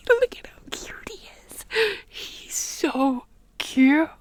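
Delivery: high, breath-only squeal fading into drawn-out words; overcome by cuteness. Real, not acted. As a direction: squeal almost voicelessly over something adorable. Gushing over her Appa plush.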